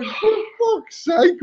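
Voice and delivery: drawn-out, high-pitched comic dismay; appalled exclamation. Retold or imitated. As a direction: wail it in mock disbelief, very slowly. Acting his shocked reaction to story.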